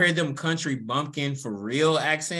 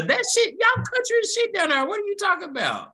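indignant outburst; 6 dB louder, faster than usual. Real, not acted. Indignant comeback at friends.

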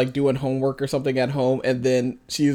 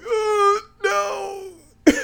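high, drawn mock wail; hammed-up horror; retold horrified dismay. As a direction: wail it in exaggerated dread. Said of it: "Graham saw my face when I was like".

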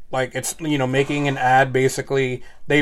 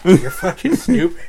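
laughing; 5 semitones higher, 6 dB louder than usual. Real, not acted. Laughing insult.

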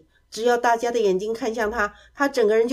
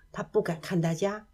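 plain reading voice

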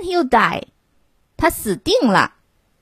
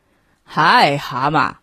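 monster roar; 5 semitones lower, slower than usual. A narrator's reading. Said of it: "怪兽咆哮道".

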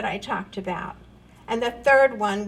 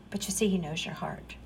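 soft whisper; 6 semitones lower, 9 dB quieter, faster than usual. Real, not acted. Preacher softly reassures after doubt.

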